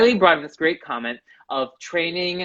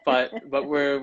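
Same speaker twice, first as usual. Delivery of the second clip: usual speaking voice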